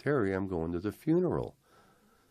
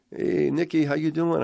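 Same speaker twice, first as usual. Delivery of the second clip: high, loud phone hello; eager greeting; 11 semitones higher, 7 dB louder than usual. Retold or imitated. Brother greets Nicky on the phone, loud and eager.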